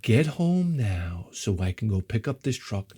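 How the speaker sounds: low, soft, restrained order; subdued demand. Retold or imitated. Husband ordering wife home, restrained and low. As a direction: drop it down low after the blow-up. Husband drained after yelling.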